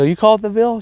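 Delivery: can't believe the nickname, pitch jumping up; surprised at the shop lady's nickname, voice shooting up; amazed question. Retold or imitated.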